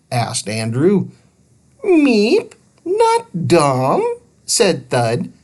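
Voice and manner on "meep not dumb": very high squeaky creature voice; little alien. Acted. Thud correcting Andrew about Harley, squeaky and high. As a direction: chirp it like a tiny alien.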